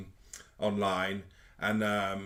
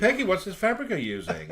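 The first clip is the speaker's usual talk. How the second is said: high, loud mock-judging voice; playful fashion-show critic, raised voice; pretend fabric buyer; 8 semitones higher, 5 dB louder than usual. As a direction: play at grilling a designer, pitched up. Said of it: "pretend to be fabric buyers".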